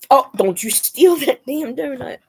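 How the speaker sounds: ordinary talk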